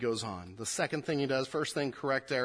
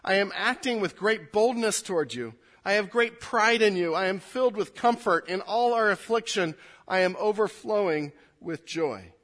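raised, earnest reading of scripture; 6 semitones higher, 6 dB louder than usual. Acted. Pastor reads Paul's letter to his congregation, earnest and raised. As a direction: proclaim the passage to the church.

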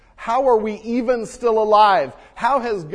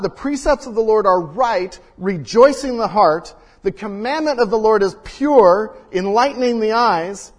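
louder, ringing scripture reading; scripture read with force; 2 semitones higher, 6 dB louder than usual. Acted. Pastor proclaiming the psalm to his congregation, loud and ringing. Preacher reads psalm aloud.

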